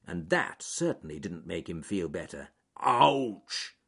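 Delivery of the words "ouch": loud, higher cry of pain from a bear; pained bear outburst; groaned yelp. A narrator's reading. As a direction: yelp as if you've hurt your foot. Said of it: "he groaned".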